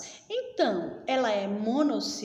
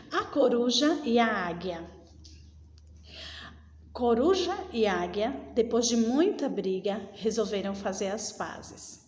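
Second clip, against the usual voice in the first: fable reading. Acted. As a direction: clear storytelling read for pupils. Teacher reads fable aloud.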